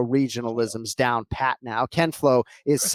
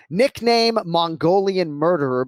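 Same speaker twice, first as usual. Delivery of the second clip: drawn-out, higher announcer-style billing; hyped nickname announcement; 4 semitones higher, 3 dB louder than usual. Pretend. Host introducing a bantamweight fighter by nickname, hyped announcement. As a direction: stretch out the nickname like a ring intro.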